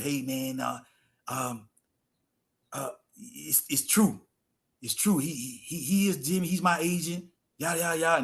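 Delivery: lightly voiced raspy caller; mild raspy-voice imitation on the phone. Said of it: "It was this raspy voice".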